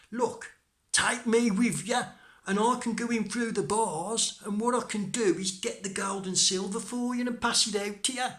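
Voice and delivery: high, loud little-hero voice; little Tom, eager. Acted. Tom offers the robbers his help, high and loud. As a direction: pipe up as tiny Tom pitching his plan.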